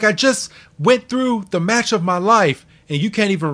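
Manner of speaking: imitating a wrestler demanding respect; aggrieved outburst. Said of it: "He's like".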